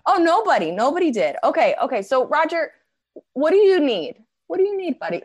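high-pitched, sweetly condescending; mock-gentle kiddie voice; talking to a toddler. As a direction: console a heckler like a small child. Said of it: "talk to them the way somebody might talk to a toddler".

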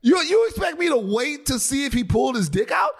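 high-pitched disbelieving question; high, exclaimed comeback; incredulous retort. Real, not acted.

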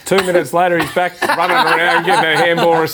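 giggling as the injury turns out fake; laughing. Real, not acted. Funny footy story, laughing.

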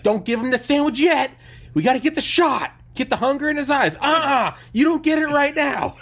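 high, loud barking director; pushy director. Retold or imitated. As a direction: bark orders like a callous film director. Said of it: "the director's like".

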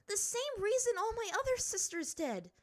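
put-on high, loud girl's shout. A narrator's reading. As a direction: defy her father at full height. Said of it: "she shouted".

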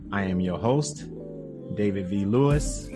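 ordinary talk, nothing marked

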